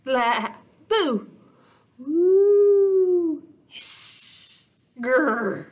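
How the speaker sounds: growly, hissing monster sounds; monster noises. Acted. Fran practising scary monster noises. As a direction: scare the dark with silly monster noises.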